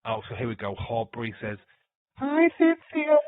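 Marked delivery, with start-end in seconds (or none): 2.2-3.2 put-on high, slow, loud child's voice. Shown as a demonstration. Streamer voicing a child character, high and drawn out. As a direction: squeak it out like a small kid. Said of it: "the child voice that I've got".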